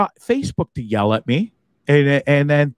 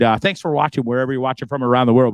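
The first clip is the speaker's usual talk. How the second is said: ordinary talk, nothing marked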